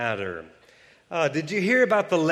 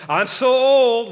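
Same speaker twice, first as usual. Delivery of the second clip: louder, higher whiny self-pity; mock self-pity; 9 semitones higher, 9 dB louder, slower than usual. Pastor mocking a self-putdown, higher and louder. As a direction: whine it like you mean it. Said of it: "if you're always saying".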